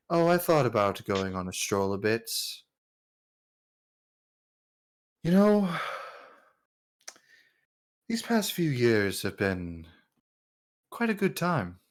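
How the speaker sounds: a hint of a musing king, long pauses; understated royal character voice; lightly voiced king at leisure. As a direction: muse like a ruler out strolling. Game master voices the king.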